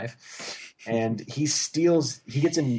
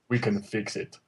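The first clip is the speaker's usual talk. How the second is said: light impression of a dubbed-over nerdy guy; lightly voiced action-star impression; Arnold's voice; 3 dB quieter than usual. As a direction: imitate the action star in a slightly altered voice. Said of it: "in, like, Arnold's voice".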